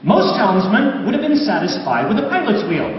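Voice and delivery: usual speaking voice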